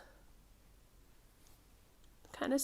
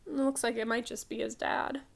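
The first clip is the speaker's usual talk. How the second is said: ordinary delivery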